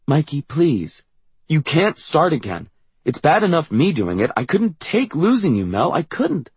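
high, urgent, distressed plea; acted outburst of fear; panicked pleading. A narrator's reading. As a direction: plead desperately, pitch climbing. Siblings panic over possible illness.

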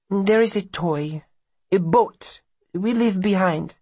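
put-on high, careful foreign-accented mother; mother's voice. A narrator's reading.

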